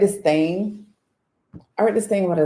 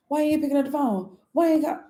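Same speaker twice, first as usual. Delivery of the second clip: high, whiny imitation; put-on pleading complaint; mock nagging; 10 semitones higher than usual. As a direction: mimic a pestering caller up high. Mimics someone nagging about calls.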